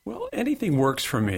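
ordinary talk, nothing marked

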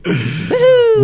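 high, loud whoop of joy; excited whoop. Real, not acted. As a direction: cheer it out high. Cheering for Friday.

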